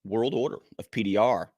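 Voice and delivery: big, punchy podcast host opening; announcer intro. Acted. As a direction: hype up the co-host like a ring announcer, with dramatic pauses. Hypes the show and co-host.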